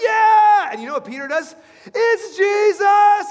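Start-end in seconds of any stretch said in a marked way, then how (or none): 0.0-0.7 high, loud whoop of joy; excited cheer. Acted. Disciples cheering at their full nets, excited high shout. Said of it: "the disciples ... are excited". 1.8-3.3 high, slow, loud shout of discovery; excited recognition. Acted. Peter spotting Jesus on the shore, slow excited shout.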